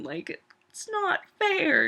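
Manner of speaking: high, slow dragged-out complaint; whiny sad. Real, not acted. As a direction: moan it, heartbroken about the show. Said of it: "making this really sad".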